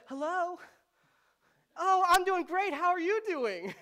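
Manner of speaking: mock sugary phone voice, a sudden swing from the argument; sweet phone voice. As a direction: switch into the polite voice you use when the phone rings. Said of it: "It's like a completely different voice".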